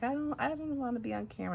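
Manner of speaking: high, reluctant mock-teen protest; nervous teen, imitated. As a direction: lightly imitate a nervous fifteen-year-old. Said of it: "Liliana's nervous".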